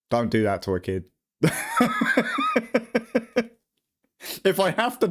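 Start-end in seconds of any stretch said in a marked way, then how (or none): 1.4-3.4 squealing high-pitched laugh. Real, not acted. Laughing at his own joke, squealing high.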